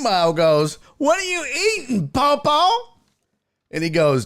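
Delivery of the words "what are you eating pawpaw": put-on high, curious little boy; kid voice. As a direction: ask like a small child. Kid voiced asking grandpa.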